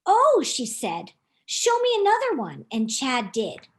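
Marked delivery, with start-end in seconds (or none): 0.1-2.6 delighted. Acted. Theodora delighted by stars.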